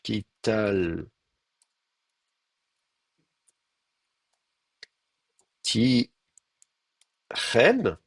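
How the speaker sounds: very slow, isolated syllables. Shown as a demonstration. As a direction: leave long gaps between syllables. Builds suffixed form while writing.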